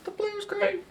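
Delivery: very high, soft parrot squeak; mock talking-bird voice; pet parrot. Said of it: "Say something".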